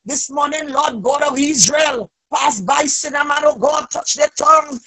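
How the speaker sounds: usual speaking voice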